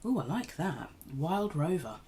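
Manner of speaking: ordinary talk, nothing marked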